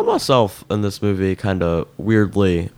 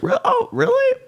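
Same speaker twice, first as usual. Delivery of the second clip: higher-pitched, sceptical retelling of a reaction; mock incredulity; 7 semitones higher than usual. Viewer reacting to the detective's unconvincing Southern accent, mock incredulous. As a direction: act out disbelief, pitched up.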